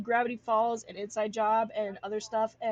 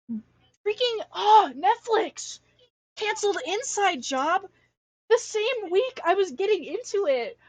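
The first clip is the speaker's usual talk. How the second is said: higher and louder, indignant rant; real exasperated outburst; worked-up rant; 9 semitones higher, 6 dB louder than usual. Real, not acted. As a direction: vent about the cancellation, all fired up. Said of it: "I'm so mad".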